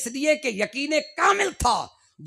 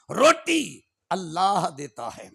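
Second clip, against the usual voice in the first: solemn slow vow; 5 semitones lower, much slower than usual. Real, not acted. Preacher swears on his point.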